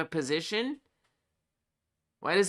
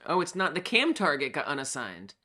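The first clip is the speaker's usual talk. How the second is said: ordinary delivery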